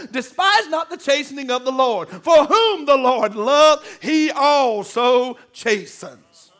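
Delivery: slow, loud drawn-out verse; preaching scripture. Acted. Preacher reading Hebrews to the church, slow and loud. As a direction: stretch the verse out like a call from the pulpit.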